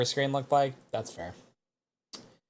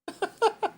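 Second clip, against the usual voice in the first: high-pitched giggle. Real, not acted. Laugh far high.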